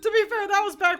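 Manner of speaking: high, loud and fast goofy voice; rushed squeaky silly voice; Doug's voice. Shown as a demonstration. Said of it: "he sounds like this".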